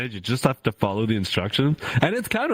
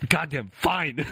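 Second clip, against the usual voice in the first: grudging, higher-pitched surrender; mock upset; 5 semitones higher, slower than usual. Streamer gives in to husband's bribe, mock grudging. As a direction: give in with comic annoyance. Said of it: "I'm so, like, upset".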